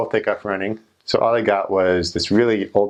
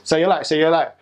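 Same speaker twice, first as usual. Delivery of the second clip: drugged-up robber; 7 semitones higher than usual. Retold or imitated. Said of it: "he goes up and says".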